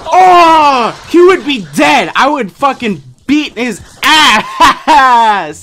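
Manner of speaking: loud high outburst; shocked shout. Real, not acted. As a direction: yell in disbelief. Shocked reaction to a clip.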